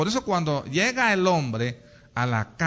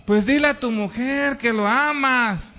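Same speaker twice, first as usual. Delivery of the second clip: consejero insistente; 6 semitones higher than usual. Acted. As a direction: urge the husband, pitched up. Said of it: "por eso dicen al hombre". (consejo insistente, en voz más aguda)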